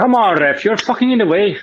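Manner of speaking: high exasperated yell at the ref; frustrated shout at the referee; pleading with the referee. Real, not acted. Yelling at referee on TV.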